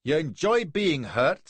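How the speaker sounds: loud, high, slow Scots voice; strong Scottish accent. A narrator's reading. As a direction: lay on thick Scots, loud and deliberate. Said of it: "in a strong Scotch accent".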